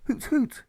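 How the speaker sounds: high piping hoot. Acted. Restorer shooting at the crowd, high hoot. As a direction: hoot it like a bird call. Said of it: "this restorer shoots".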